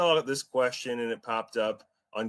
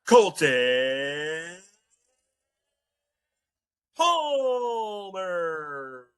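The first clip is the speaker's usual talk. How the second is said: drawn-out, higher announcer call of a name; 7 semitones higher, much slower than usual. Acted. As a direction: stretch the name out like a ring announcer. Host announces co-host dramatically.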